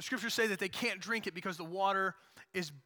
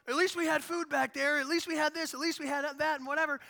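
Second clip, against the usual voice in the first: whiny complaint; 6 semitones higher than usual. Retold or imitated. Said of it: "they're like".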